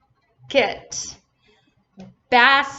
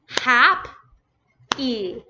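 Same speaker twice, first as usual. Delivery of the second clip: Hap- and E far apart, a long pause between; teacher splitting the word into Hap- and E; broken into syllables. Shown as a demonstration. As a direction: sound out Hap-, then E, as separate beats. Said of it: "So two vowels".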